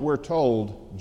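normal talking voice

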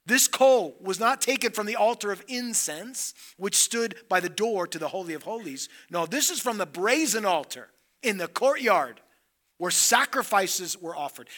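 loud, emphatic pulpit delivery; preaching. Acted. Preacher explaining the altar coal, loud and fervent. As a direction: proclaim it to the congregation.

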